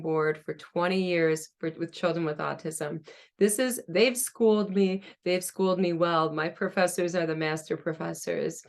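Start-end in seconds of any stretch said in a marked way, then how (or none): none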